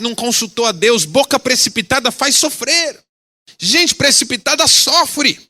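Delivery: high, loud fiery sermon delivery; fervent preaching. Acted. Pastor warning the congregation, preaching hard. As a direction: preach it with rising fire. Preacher rising to full force.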